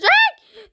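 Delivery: a sudden high loud yelp; sharp yelp. Real, not acted. Gamer getting hit in the match, sudden high yelp.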